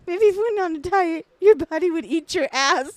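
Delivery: high, loud put-on mom voice; hammed-up high, loud scolding; mock scolding mom. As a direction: jeer like an exaggerated nagging mother. Voicing mom's insult about dieting.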